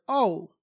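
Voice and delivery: a plain, matter-of-fact "oh"; neutral acknowledging oh. Shown as a demonstration. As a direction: say "oh" as simple acknowledgement. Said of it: "conveys a certain meaning just by using that tone".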